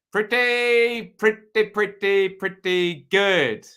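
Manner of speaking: slow, rising-high teasing build on each pretty; savouring a slow build to good; drawn-out pretty good. Acted. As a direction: stretch every pretty, then land on good.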